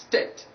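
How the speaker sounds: "state" as "stet"; plain short e in "state", no glide; short vowel three. Shown as a demonstration. Teacher demonstrating the mistaken vowel, short e. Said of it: "or vowel number three".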